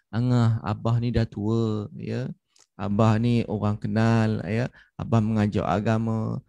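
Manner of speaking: slow, low, heartfelt fatherly appeal; gentle persuasion. Retold or imitated. Father opening up to his child about himself, gently persuading. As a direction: coax the child slowly and tenderly. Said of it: "dia gunakan intonasi pendekatan yang cukup luar biasa".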